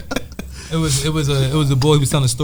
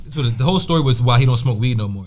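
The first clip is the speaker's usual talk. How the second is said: ordinary talk, nothing marked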